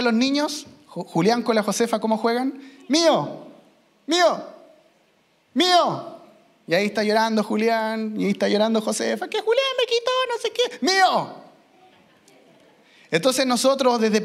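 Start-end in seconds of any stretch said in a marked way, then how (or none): 2.9-6.2 put-on higher, childish mío; greedy child. Preacher imitates kids claiming toys, childish whine. 9.3-11.4 much higher tattling whine; whining child. Retold or imitated. Josefa crying that Julián took her toy, high whine.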